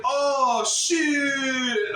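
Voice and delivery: high, loud "Oh, shit" mimicry; mocking shocked yell. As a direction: throw the crowd's cry back at them. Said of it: "somebody's like".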